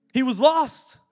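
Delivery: a preacher's loud cry of lost; proclaiming. Acted. Dramatic retelling of parable.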